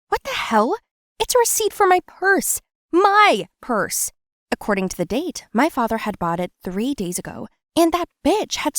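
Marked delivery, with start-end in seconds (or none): 0.1-4.1 much higher, louder indignant outburst; outraged cry over the stolen purse; furious discovery. Acted. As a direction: spit out the betrayal. Finds the purse receipt.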